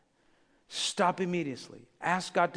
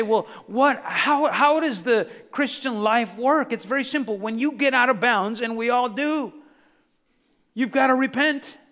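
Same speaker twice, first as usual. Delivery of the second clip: louder, higher preaching at full force; fired-up preaching; 4 semitones higher, 6 dB louder than usual. Acted. Pastor preaching hard to his congregation, fervent.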